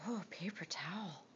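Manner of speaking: whispering. Real, not acted. Whispered aside.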